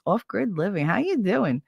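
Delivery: ordinary delivery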